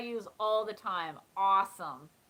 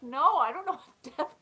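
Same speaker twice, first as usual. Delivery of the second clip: baffled protest; 5 semitones higher, 6 dB louder than usual. Retold or imitated. Retold baffled reply to friend.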